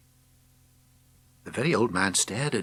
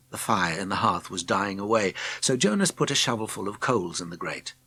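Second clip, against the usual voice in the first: ordinary delivery, nothing marked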